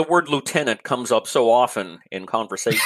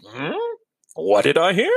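high, questioning double take; mock perked-up curiosity; 9 semitones higher than usual. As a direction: act it out, pitch rising as if catching a clue. Acts reacting to hearing washroom.

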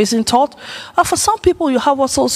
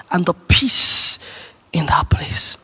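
slow, trailing into a whisper; hushed peace; 6 semitones lower, slower than usual. Retold or imitated. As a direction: let the calm fade into a whisper. Picturing the peaceful Garden of Eden.

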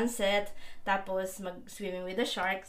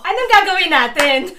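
laughing; 9 semitones higher, 19 dB louder than usual. Real, not acted. Laughing while asking, excited.

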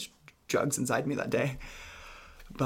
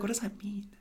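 bewildered inner thought; 8 semitones higher, 5 dB quieter than usual. Retold or imitated. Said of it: "I was like".

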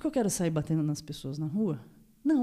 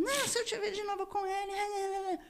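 very high, whiny squeal, softer; mock threat; 13 semitones higher, 4 dB quieter than usual. Victim mimicking the jealous attacker's threat, whiny mock squeal. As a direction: caricature a jealous rival in a squeaky whine.

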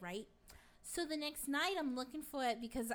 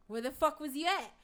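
acted loud, higher confrontation; angry demand; 4 semitones higher, 9 dB louder than usual. Wife confronts husband back after a week, angry demand. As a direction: demand an answer, raised voice.

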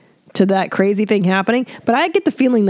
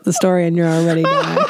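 normal talking voice

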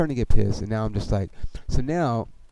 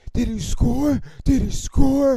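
high, loud taunting imitation; mock a rival fan with a high jeer; talking trash; 6 dB louder, slower than usual. Rival fans after the runner stops at third, jeering. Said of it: "these dudes start talking shit".

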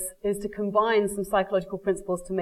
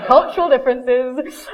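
laughing; 4 semitones higher, 7 dB louder, slower than usual. Real, not acted. Laughing aside about husband.

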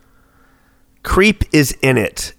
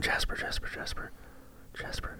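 whispered name; 23 dB quieter than usual. Real, not acted. Searching memory for the Jasper voice actor, whispering. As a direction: breathe the name to yourself to jog memory.

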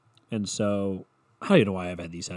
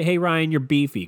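higher, louder, tossed-off "Hey, Ryan, you're beefy"; the rude way; 5 semitones higher, 6 dB louder than usual. Shown as a demonstration. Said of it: "Don't just say".

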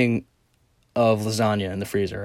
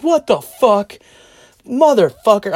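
loud, high-pitched swearing; re-enacted exasperated outburst, pitched high; upset cursing; 10 semitones higher, 7 dB louder than usual. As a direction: curse at yourself at full volume. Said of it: "I'm cursing like the fucking woman downstairs".

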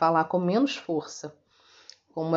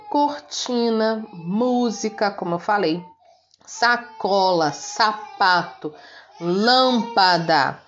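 slow careful examples; 3 semitones higher, slower than usual. Shown as a demonstration. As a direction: read each example word slowly. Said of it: "teacher models example words".

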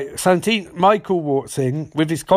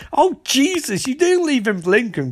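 alarmed; 9 semitones higher than usual. Retold or imitated. Barry's alarmed reaction.

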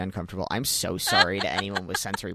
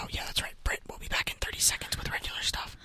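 ASMR whisper. Pretend. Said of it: "A S M R style".

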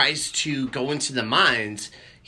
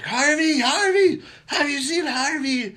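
high, urgent calling out; frantic calling; 9 semitones higher than usual. Retold or imitated. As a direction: call out in a panic, higher. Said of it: "she's like".

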